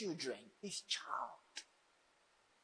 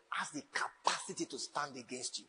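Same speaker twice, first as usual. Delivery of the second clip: whisper; 4 semitones lower, 8 dB quieter than usual. Acted. Preacher drops to whisper for effect.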